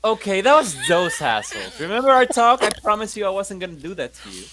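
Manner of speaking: usual speaking voice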